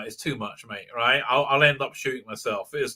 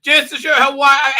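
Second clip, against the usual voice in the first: put-on Southern accent; 10 semitones higher, 7 dB louder than usual. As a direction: retell the guy's line in a hammed-up Southern accent. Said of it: "he had the Southern accent".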